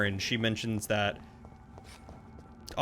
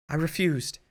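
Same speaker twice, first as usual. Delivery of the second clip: put-on higher British accent sliding into Irish; 6 semitones higher than usual. Streamer switches the diary writer to British on chat's request, higher British accent. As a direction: try for a refined British voice, not quite holding it. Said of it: "It's kinda slipping into Irish".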